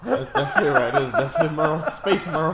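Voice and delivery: loud laughing through the words; laughing hard. Real, not acted. Friend cracking up at a movie joke, loud laughing. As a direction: crack up as you say it.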